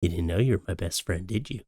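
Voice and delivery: soft, close and low; hushed, teasing, close to the mic; right in your ear. Pretend. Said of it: "whisper in your ear".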